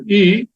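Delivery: E drawn as a long Italian I; Italian I sound. Shown as a demonstration. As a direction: say E like Italian I, not short I. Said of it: "like an Italian I".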